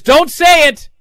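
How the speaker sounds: high, loud, urgent plea; pleading mock yell. Miz begging A J not to say it, loud plea. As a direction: beg him to stop, rising panic.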